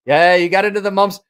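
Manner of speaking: loud, high mock British mate; botched British accent. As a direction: blurt it in a bad British accent. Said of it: "can't do a British".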